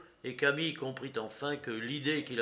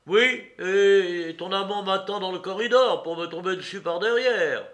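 loud, raised accusing voice; angry, suspicious man; 7 semitones higher, 11 dB louder than usual. A narrator's reading. Courrapier suspects a lover in ambush, angry. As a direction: accuse her of setting a trap.

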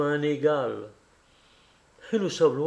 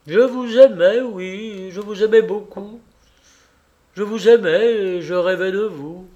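higher and louder, leaning on "aimais"; passionate confession; 4 semitones higher, 7 dB louder than usual. Acted. Kolia confessing his affection to Alexei, ardent and loud. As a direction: pour out the admission.

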